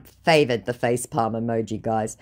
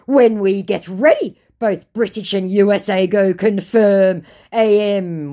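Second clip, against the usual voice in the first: mock scammer threat; 6 semitones higher, 8 dB louder than usual. Scammer threatening war on her, mock menacing. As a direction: declaim a muddled warning grandly.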